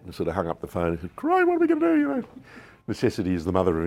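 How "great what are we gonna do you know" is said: a high, loud panicked squeak after hanging up; panicked squeal. Retold or imitated.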